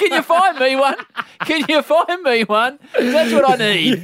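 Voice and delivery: host amused by the advice, laughing. Real, not acted. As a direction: ask it while cracking up.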